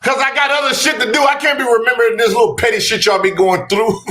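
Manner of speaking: hammed-up exasperated producer; mock Gilbert. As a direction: play the busy producer brushing it off. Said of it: "And he was like".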